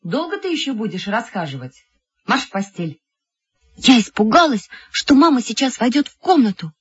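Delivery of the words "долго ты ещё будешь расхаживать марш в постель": softer, as if through a wall; restrained bedtime order; muted motherly scolding. A narrator's reading. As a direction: scold quietly from the next room.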